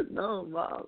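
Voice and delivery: high, soft, choked-up reply; half-laughing mock tearful protest; retold tearful reply. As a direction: imitate crying to mom, voice small. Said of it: "I call mom crying".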